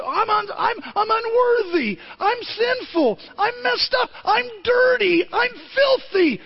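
high, anguished confession of unworthiness; pleading sinner. Retold or imitated. A sinner pleading before God, high and desperate.

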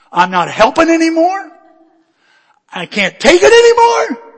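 high, very loud tantrum; hysterical shouting. Retold or imitated. Husband at wife over wrong passage, hysterical shouting. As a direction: scream it at the end of your rope. Acted meltdown at his wife.

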